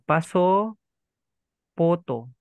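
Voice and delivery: "Paso photo" split into two separate parts; Japanese-style pronunciation. Shown as a demonstration. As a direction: say "Paso", pause, then "photo". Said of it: "ganito po yung marinig nyo".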